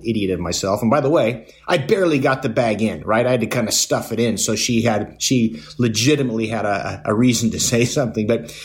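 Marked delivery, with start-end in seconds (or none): none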